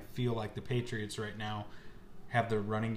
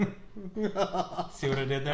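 laughing; 6 semitones higher, much slower than usual. Real, not acted. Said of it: "I get it".

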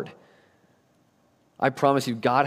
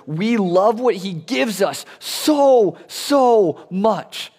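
so much stretched out slowly, higher; preacher stretching so much to the congregation; slow emphatic build; 9 semitones higher, 4 dB louder, much slower than usual. Real, not acted. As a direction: draw out so so much, pleading.

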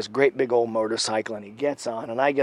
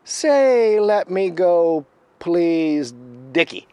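loud, high, slowly drawn-out order; imitated taunting command, loud and measured; Critter; 5 semitones higher, 5 dB louder, much slower than usual. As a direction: dictate it forcefully, stretching the words. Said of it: "the other voice says".

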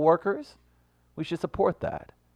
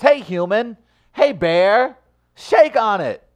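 high, loud appeal; rallying peacemaker; 11 semitones higher, 12 dB louder, slower than usual. Acted. Would-be peacemaker hailing a bear, loud and rallying. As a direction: call out a truce with full voice. Pitches a human-bear truce.

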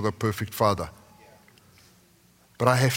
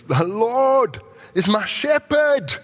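much higher, louder, slow and ringing; exclaimed with joy; 12 semitones higher, 4 dB louder, much slower than usual. Shown as a demonstration. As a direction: proclaim "The Lord is my shepherd" like a jubilant exclamation, much higher and louder. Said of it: "There's an exclamation point there".

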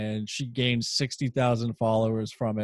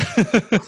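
sudden high burst of laughter; loud laugh; 9 semitones higher, 5 dB louder than usual. Real, not acted. Big laugh at own joke.

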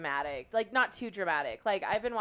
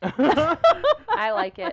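very high, loud, slow squeal with laughter; mock squeal; 12 semitones higher, 12 dB louder, much slower than usual. Friend teasingly defends the show in a high squeal. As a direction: squeal it out in silly delight.

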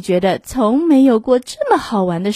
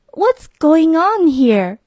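slow, higher startled cry; mother shocked at the plant-filled house, aghast; shocked gasp; 7 semitones higher, 3 dB louder, slower than usual. Acted. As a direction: stretch it out in disbelief. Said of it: "she gasped".

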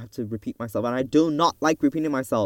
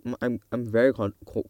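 ordinary talk, nothing marked

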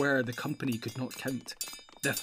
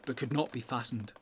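usual speaking voice

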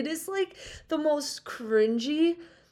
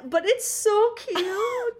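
gushing; 9 semitones higher, much slower than usual. Real, not acted. Gushing over cute scene.

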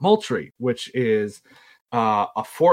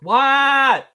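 high, loud shout back; calling back; 14 semitones higher, 6 dB louder than usual. Retold or imitated. Answering brother from the other room, a loud high shout. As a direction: holler it back down the hall.

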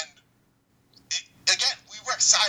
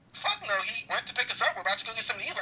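much higher, indignant retold protest; imitated outraged coworker; 8 semitones higher than usual. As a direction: bark it back at the officer in her voice. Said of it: "And she's like".

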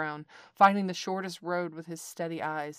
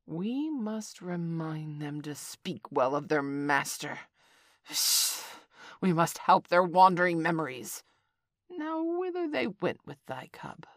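menacing snake; 3 dB louder than usual. Acted. Kaa menacing about the monkeys.

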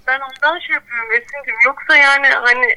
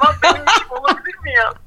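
laughing; 4 semitones higher, faster than usual. Real, not acted. Laughs at own thought.

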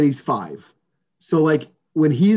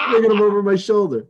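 laughing; 5 semitones higher, faster than usual. Real, not acted. Laughing at imagined future struggle.

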